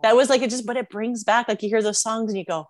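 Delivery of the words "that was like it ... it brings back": normal talking voice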